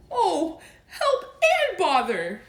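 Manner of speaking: high, slow, laughing through it; rueful laugh. Acted. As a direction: laugh helplessly at your plight. Pooh stuck, half laughing.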